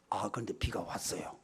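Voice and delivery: whispered, awed; a soft whispered punchline; hushed reveal. Real, not acted. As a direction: drop to a whisper for the miracle. Dramatic reveal of the rain.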